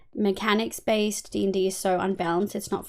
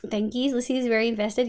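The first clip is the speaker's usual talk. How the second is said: normal talking voice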